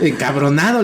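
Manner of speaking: normal talking voice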